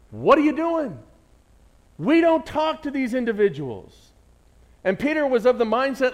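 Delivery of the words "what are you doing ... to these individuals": loud, high-pitched indignant challenge; acted accusation. Preacher acting out Peter challenging Jesus, accusing and loud. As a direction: raise pitch and volume as if calling someone out. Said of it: "accusing Jesus".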